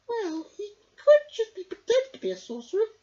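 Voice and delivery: put-on high, loud crackly old woman voice; a wise old sister musing; old Myrtle. A narrator's reading. Myrtle talking to her brother, high and crackly. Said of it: "she said".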